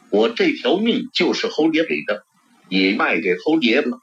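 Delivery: usual speaking voice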